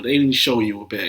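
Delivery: ordinary talk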